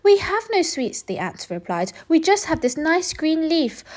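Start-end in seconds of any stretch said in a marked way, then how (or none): 0.0-1.0 much higher tiny voices; tiny ants. Acted. Ants answering the rat, high and tiny. 2.1-3.8 much higher tiny voices; tiny ants. Acted. Ants answering the rat, high and tiny.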